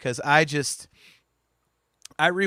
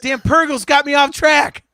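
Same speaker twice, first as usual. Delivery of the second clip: mock annoyance; 9 semitones higher, 10 dB louder than usual. Mock rant winding down.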